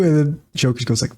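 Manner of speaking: normal talking voice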